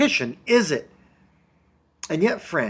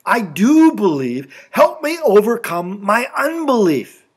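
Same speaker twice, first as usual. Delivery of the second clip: raised, louder plea for help; pleading admission; 5 semitones higher, 6 dB louder than usual. Retold or imitated. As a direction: confess it as an earnest, higher-pitched appeal. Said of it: "He even admits it".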